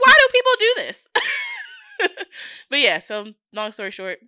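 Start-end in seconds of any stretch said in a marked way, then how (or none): 0.0-1.1 much higher and louder, yelling why; exasperated shout. Retold or imitated. She yells her exasperation at the ocean trip to friends. Retelling ocean fear, exclaiming. 1.1-2.6 very high burst of laughter; shrieking laugh. Real, not acted. Laughing hard after yelling about the ocean, very high.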